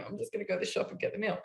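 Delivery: soft, fast sheepish mumble; quick nervous retreat. Retold or imitated. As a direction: back away trying not to provoke her. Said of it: "just backing out of the room going".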